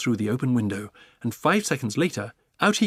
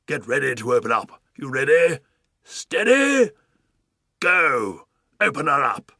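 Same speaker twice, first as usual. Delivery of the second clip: commanding countdown; 2 semitones higher, 7 dB louder than usual. Acted. Duke cueing the pelican to open, loud countdown. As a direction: count it down like a drill sergeant.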